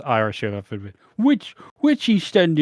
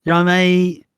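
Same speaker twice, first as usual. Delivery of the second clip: loud, higher, chummy call; loud cheery greeting; 7 semitones higher, 8 dB louder than usual. Retold or imitated. Neighbour calling from his car, loud and chummy. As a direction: hail him loudly from a slowing car. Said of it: "looking at me like".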